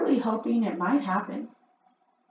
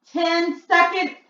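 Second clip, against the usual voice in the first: higher, slow, loud gloating call; crying out; 11 semitones higher, 8 dB louder, slower than usual. A narrator's reading. Grand High Witch counting down to the boy, slow gloating cry. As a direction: draw the seconds out, gloating. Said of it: "cried the Grand High Witch".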